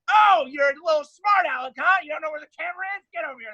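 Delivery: high, loud scorn dropping to a fast grumble; mock him, then order him over; scornful cop. Booking cop sneering at the confused prisoner, loud then fast.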